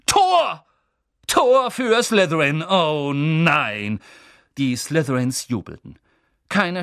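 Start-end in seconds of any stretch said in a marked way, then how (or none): 0.1-4.0 high, loud goal call; goal shout. Acted. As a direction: yell out the goal.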